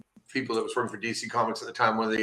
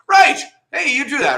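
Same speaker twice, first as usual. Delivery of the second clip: grumpy guy voice; 13 semitones higher, 10 dB louder than usual. Retold or imitated. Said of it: "He's kinda grumpy".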